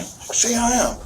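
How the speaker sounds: high, tearful imitation of a demand; retold crying. Father imitating his crying son to detectives, tearful. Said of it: "like, crying".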